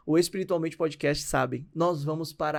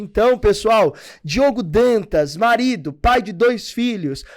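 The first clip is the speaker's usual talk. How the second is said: announcer introduction; 2 semitones higher, 5 dB louder than usual. Acted. Host presenting the guest pastor to viewers, announcer style.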